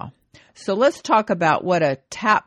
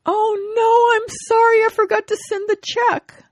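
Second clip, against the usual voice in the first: put-on old wife voice; 5 dB louder than usual. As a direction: pipe it up sweetly like the wife. Wife Esther answers in joke.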